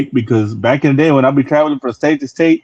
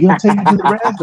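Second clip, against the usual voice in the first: mimicking the girls; 6 semitones higher, faster than usual. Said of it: "Then bitches like".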